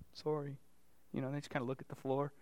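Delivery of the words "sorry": a soft, grudging mumbled sorry; muttered sorry. Retold or imitated. Child forced to apologize to a sibling, soft grudging mutter. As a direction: mumble it at the floor. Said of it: "barely mutter it out".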